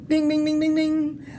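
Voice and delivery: ding ding. Retold or imitated. Bell imitation.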